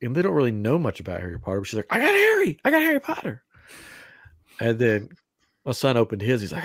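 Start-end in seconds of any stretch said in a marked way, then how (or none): 1.9-3.4 put-on high-pitched excited little-kid squeal; excited child. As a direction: imitate a thrilled kid announcing a prize, much higher. Voicing daughter's excited announcement.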